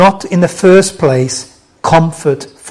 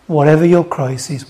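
ordinary delivery

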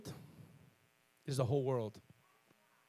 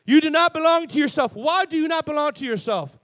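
full-force preaching; 6 semitones higher, 7 dB louder than usual. Real, not acted. Preacher telling the congregation they were bought, full-force preaching. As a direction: preach it loud, stretching the big words.

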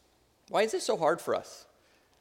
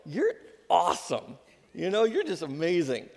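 tender praise. Acted. Parent praising child, eye to eye.

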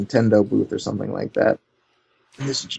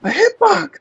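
excited shout; 4 dB louder than usual. Retold or imitated. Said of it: "He's like".

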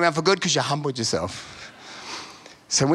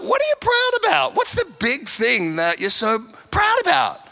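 squeaky high, incredulous challenge; mock a proud person with a high, disbelieving voice; high-pitched, mocking disbelief; 9 semitones higher than usual. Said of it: "And I'm like".

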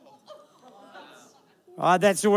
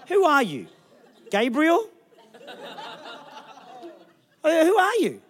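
higher, louder, laughing disbelief; 9 semitones higher, 4 dB louder, slower than usual. Real, not acted. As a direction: laugh at the absurd question. Mocking the questioning couple.

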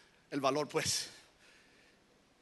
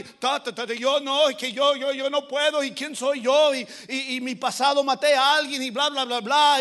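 raised, rattled-off stuttering excuses; stammering Moses; 4 semitones higher, 8 dB louder than usual. Acted. Moses makes excuses to God, stammering. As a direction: stammer excuse after excuse, then blah-blah. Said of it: "tardo en hablar y to-, to-, torpe".

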